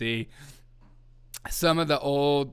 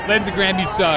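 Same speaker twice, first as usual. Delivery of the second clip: loud jeering yell at Lindsey Graham; mock crowd heckle; 5 semitones higher, 9 dB louder than usual. Streamer yelling at Lindsey Graham like a booing crowd. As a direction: boo him like the rally crowd.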